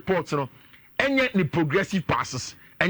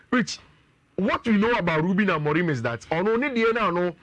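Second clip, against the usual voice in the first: usual speaking voice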